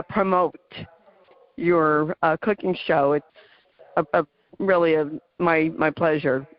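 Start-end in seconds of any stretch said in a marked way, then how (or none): none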